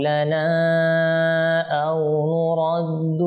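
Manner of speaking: slow, melodic Quran chanting; solemn drawn-out tilawa; Quran recitation. Acted. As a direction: draw each word out in measured tajwid recitation. Recites Quran verses with music.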